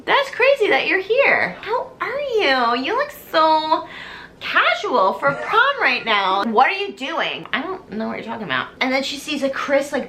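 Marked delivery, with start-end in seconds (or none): 0.0-7.5 put-on busted girl overdoing the sweetness; fake cheer. Caught Norma fakes cheer. 7.5-8.7 fast, quieter brush-off; defensive mumble; quick denial. Retold or imitated. Norma denies quickly.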